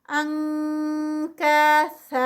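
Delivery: انکاسن slow with the نون as an nk sound; nk recitation. Shown as a demonstration. Teacher demonstrates the ink sound slowly. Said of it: "ٹو counts پہ اینڈ پہ".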